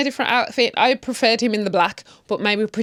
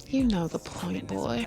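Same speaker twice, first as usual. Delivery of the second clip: sultry tease; 10 dB quieter, slower than usual.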